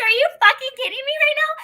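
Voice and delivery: fast, very high incredulous yell; shrieked retold outburst; outraged disbelief. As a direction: explode in exasperated disbelief.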